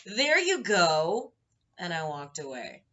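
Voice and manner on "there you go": loud, high, drawn-out sing-out; exaggerated triumphant send-off; mock parting shot. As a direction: stretch it out slow and loud. Acted parting shot at till.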